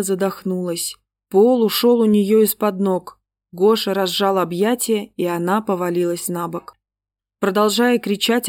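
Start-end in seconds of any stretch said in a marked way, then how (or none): none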